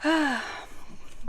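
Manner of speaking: long, soft sigh; audible sigh, falling away; weary sigh. Real, not acted. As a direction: let out a soft exhale before moving on. Frustrated about the house sale.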